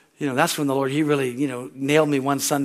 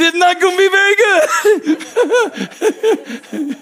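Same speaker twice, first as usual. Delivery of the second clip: sheepish admission; 13 semitones higher, 7 dB louder than usual. Retold or imitated. Confessing a grumpy attitude to God.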